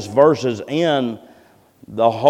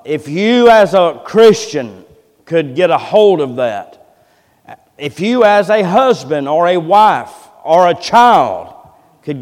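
loud, slow pulpit exhortation; fervent preaching; 5 semitones higher, 8 dB louder than usual. Real, not acted. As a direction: press each phrase on the congregation, loud and drawn out. Exhorts the congregation.

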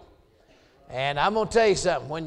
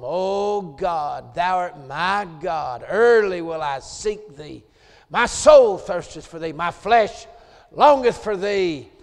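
building from calm to loud preaching; fervent psalm reading; 7 dB louder than usual. Acted. Preacher crying out the psalm to his congregation, fervent. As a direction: pour the psalm out louder and louder.

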